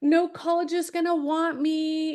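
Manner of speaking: upset son. Retold or imitated. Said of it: "And he's like".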